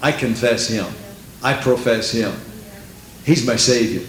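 ordinary delivery

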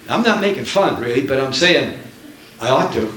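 ordinary delivery

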